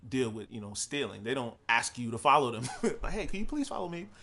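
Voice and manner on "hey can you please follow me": higher, softer sweet imitation; lightly voiced sweet clerk; friendly polite clerk. Clerk asking shoplifters to follow her, mild sweet voice. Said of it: "she was so friendly about it".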